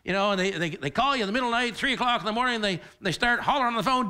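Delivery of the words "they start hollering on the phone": ordinary talk, nothing marked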